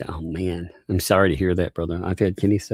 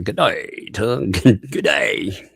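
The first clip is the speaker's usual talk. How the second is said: bad Australian accent; 3 semitones higher, 3 dB louder, much slower than usual. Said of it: "I'm terrible with accents".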